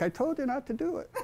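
wife's told-you-so. Retold or imitated. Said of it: "my wife is like".

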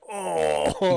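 long, drawn-out waking-up groan. Acted. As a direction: groan like getting up on a bad morning. Acting out waking up achy.

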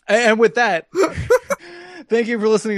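laughing as you wrap up. Real, not acted. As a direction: try to sign off while cracking up. Awkward sign-off through laughter.

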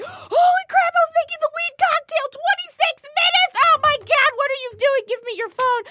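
high, frantic mock alarm at normal volume; a frantic friend grabbing the phone, pitched high; panicked friend. To her high roommate, mock panic over the voicemail, pitched high. Acting Alana's panicked discovery.

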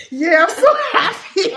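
high, loud gleeful laugh-talk. Retold or imitated. A child gloating after the fight, laughing. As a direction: crow with delight, laughing. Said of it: "I'm like".

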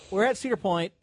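ordinary talk

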